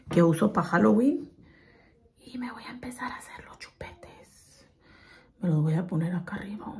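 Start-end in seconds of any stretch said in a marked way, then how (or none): 2.2-6.7 soft whisper. Real, not acted. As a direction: whisper while working. Whispering while applying makeup.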